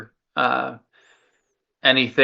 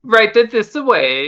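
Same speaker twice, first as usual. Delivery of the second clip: slow, higher, louder Italian gnome voice; improved gnome accent; 9 semitones higher, 4 dB louder, slower than usual. Acted. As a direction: lead them on with a sing-song Italian gnome voice. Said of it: "That's an improvement".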